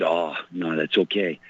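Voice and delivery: ordinary talk, nothing marked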